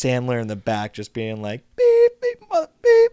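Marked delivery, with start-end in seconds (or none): 1.8-3.1 high, loud imitated bleeps; censor bleep. Retelling Sandler's bleeped swearing in the background, imitated censor beeps. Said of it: "it's just bleeping out every word".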